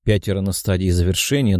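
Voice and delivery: plain reading voice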